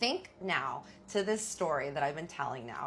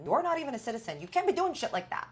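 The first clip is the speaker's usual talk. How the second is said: higher, louder telling-off; heated scolding; 9 semitones higher, 6 dB louder, faster than usual. Retold or imitated. Wife scolding husband for beating up a man, heated. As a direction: scold him for the fight.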